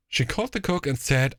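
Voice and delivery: ordinary delivery, nothing marked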